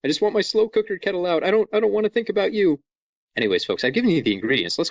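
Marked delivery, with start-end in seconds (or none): 0.0-2.8 higher, quicker comic complaint; mock exasperation. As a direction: whine it fast at an annoying appliance. Acted complaint to a kitchen item.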